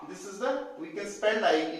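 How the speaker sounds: ordinary delivery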